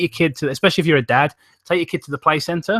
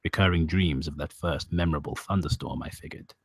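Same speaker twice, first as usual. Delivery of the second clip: creepypasta story narration; 8 semitones lower, 8 dB quieter than usual. A narrator's reading. Narrator recalls a child's dreams of storms, sombre narration. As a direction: narrate a sombre tale, low and hushed.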